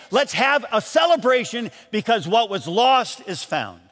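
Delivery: a preacher building up the joy of the parables; preaching. Acted.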